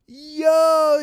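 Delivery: loud, high, drawn-out cry; big astonished outburst; shocked exclamation. Real, not acted. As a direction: react in amused shock. Exclaims at coffee spill.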